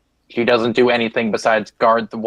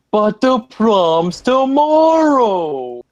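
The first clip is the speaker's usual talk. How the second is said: put-on much higher, very slow girly whine; Catherine's voice; 12 semitones higher, 5 dB louder, much slower than usual. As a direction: whine it like a teen girl. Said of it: "Catherine does basically say".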